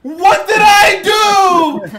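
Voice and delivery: loud, slow, high laughing shout; outraged shout. Real, not acted. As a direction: yell it in mock outrage. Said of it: "outraged at being roasted".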